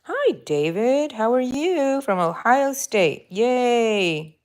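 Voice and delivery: high cheerful greeting; excited. Real, not acted. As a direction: greet him brightly. Greeting a live viewer.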